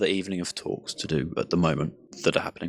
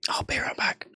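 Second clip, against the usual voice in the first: whispering. Real, not acted. Stepping away, whispering aside.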